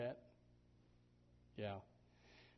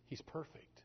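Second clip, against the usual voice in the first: hushed whisper; soft reverent whisper; 4 semitones higher, 5 dB quieter than usual. Real, not acted. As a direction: breathe it out in awe.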